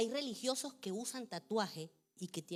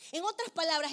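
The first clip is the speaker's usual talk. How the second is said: usual speaking voice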